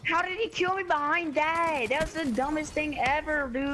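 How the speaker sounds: high, slow angry rant; raging gamer. Real, not acted. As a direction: fume at the cheap kill. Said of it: "I'm mad".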